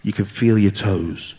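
low, slow mock meditation guide; hammed-up spiritual guide, drawn out; slow soothing guide. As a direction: slowly talk him down into calm. Shaman guiding relaxation slowly.

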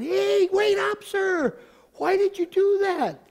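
high, loud, drawn-out mock holler; calling after someone. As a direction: imitate someone running out shouting after a stranger. Retold person running out, calling after him.

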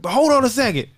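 put-on high, loud kid voice; imitated kid calling out. Kid onstage asking mom's permission to cuss, high calling voice. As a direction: call across the crowd as an eager child.

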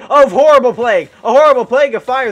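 loud, dramatic cry of horror; alarmed exclaiming. Real, not acted. Gamer flung by fiery mob, alarmed exclaiming. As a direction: declaim the horror at full volume.

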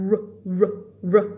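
R- sound held alone; drilled R sound. Shown as a demonstration. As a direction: isolate the R, over and over. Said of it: "ตัว R กับตัว L". (ฝึกออกเสียง R ซ้ำๆ)

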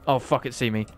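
normal talking voice

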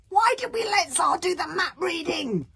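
loud, very high wail; panicked, complaining outcry. A narrator's reading. Bodkin blames Tsar for the map, wailing. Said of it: "wailed Bodkin".

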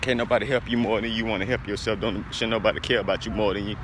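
ordinary delivery

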